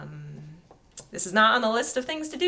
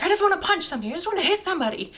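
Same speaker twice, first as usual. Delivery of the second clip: frustrated outburst; 10 semitones higher, much faster than usual. Retold or imitated. Grieving mother voiced in retold scene.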